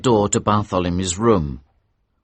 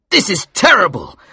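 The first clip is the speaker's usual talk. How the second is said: higher and louder, shaken; horrified; 11 semitones higher, 5 dB louder than usual. A narrator's reading. Watson to Holmes after seeing the face, horrified. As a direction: gasp it out in horror. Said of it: "Watson horrified".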